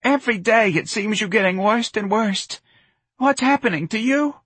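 high, wailing mother's lament; distraught mother, high and plaintive; tragic lament. Acted. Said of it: "Missus Riley cried tragically".

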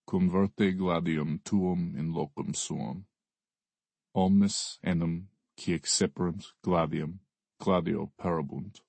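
put-on slow, grave Latin quote, Russian accent; solemn Latin recitation. A narrator's reading. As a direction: intone it like scripture. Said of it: "I don't understand Latin".

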